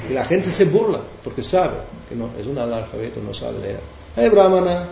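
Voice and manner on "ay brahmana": a high, loud taunting call to the brahmana; mocking teaser. Villagers mocking the illiterate brahmana, in a high taunting voice. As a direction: tease him like the jeering villagers. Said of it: "Prabhupada imita a su".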